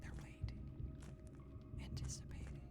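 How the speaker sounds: hushed, mock-spooky whisper; playfully sinister, barely voiced; ominous whisper. Playful ominous aside about being watched.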